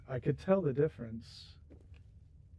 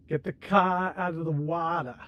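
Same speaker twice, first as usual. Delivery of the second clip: imitating a thick Maine accent, r's dropped; New England accent; 4 semitones higher, 4 dB louder than usual. Streamer mimics the local New England accent. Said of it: "they have a very distinctive accent".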